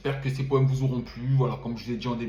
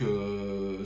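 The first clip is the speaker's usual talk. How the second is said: usual speaking voice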